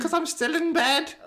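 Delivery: high, put-on phone voice; hammed-up high-voiced character; Tina. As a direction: answer the phone in a silly falsetto. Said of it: "It's Tina".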